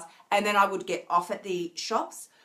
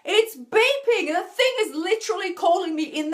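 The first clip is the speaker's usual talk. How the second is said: excited exclaim; 9 semitones higher, 3 dB louder than usual. Real, not acted. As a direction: let the surprise lift it up.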